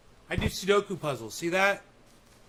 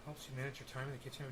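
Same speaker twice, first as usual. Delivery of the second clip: quick, softer mutter, half to himself; skeptical echo; 5 semitones lower, 14 dB quieter, much faster than usual. Acted. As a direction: repeat it under your breath, doubtful. Echoing the claim in disbelief.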